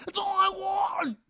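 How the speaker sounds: very high, drawn-out mock wail; imitated meltdown scream. Zach crashing out across the hills, high tearful mock scream. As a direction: shriek it like a hammed-up breakdown. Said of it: "the sounds of Zach crashing out".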